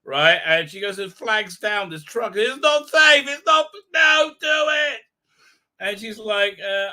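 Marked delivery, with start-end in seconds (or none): 2.3-5.0 high, loud urgent warning; mock panic, shouted high; alarmed shout. Warning her not to flag truck.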